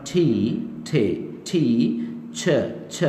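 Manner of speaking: ठी and छ drilled one by one with puffs of air; slow isolated ठी, ठे, छ, strongly aspirated; aspirated sounds demo. Shown as a demonstration. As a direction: break टीचर into ठी and छ, no r. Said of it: "इसको मैं पढ़ रहा हूँ".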